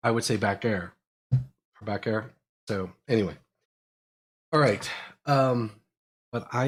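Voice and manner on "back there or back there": "back air" said for back there, with no th sound; Pittsburgh back air. Shown as a demonstration. Showing how he used to say back there: back air. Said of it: "instead of saying back there".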